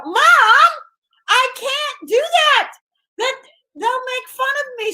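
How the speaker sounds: high, whiny pleading daughter; pleading young self. Retold or imitated. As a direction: beg your mom in a high whine. Young [name] pleading with mother.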